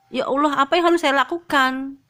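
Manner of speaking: retold anguished question to Allah; pleading prayer. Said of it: "saya bertanya kepada Allah". (doa memohon dengan nada lebih tinggi)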